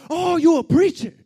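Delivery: very loud, high mocking shout; unchanged yelling officer. Officer reacting to the preacher's answer, scornful shout. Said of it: "he didn't change nothing at all".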